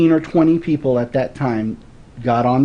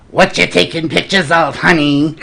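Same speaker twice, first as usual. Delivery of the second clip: imitated New Jersey accent, louder and higher, with a laugh; 4 semitones higher, 5 dB louder than usual. Woman on her doorstep calling to a passing photographer, New Jersey accent. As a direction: call out the question in a broad New Jersey accent. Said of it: "in a real pronounced New Jersey accent".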